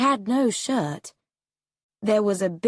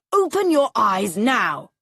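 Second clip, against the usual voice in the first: louder, commanding father; stern order; 3 semitones higher, 5 dB louder than usual. A narrator's reading. Father ordering his frightened son to look. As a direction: bark the order at the boy.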